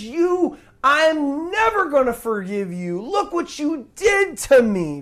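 high-pitched acted indignation; aggrieved outburst, slowing at the end; angry refusal. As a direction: play the wronged servant, voice pitched right up. Acting servant grabbing debtor by throat.

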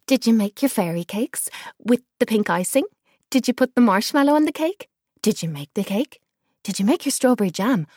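high, loud giddy torrent of questions; giddy jabbering. Acted. Excited girl pestering grandma about the cakes, giddy and loud. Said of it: "Lila jabbered on and on in a giddy hysteria".

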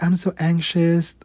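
ordinary talk

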